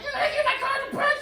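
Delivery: high fast squeaky woman voice; suspicious shriek. Retold or imitated. Woman questioning the door guy, high and squeaky. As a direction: squeal it in disbelief.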